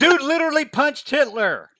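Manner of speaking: high, loud disbelief; incredulous shout. Real, not acted. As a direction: yell the obvious comeback. Incredulous shouted comeback amid laughter.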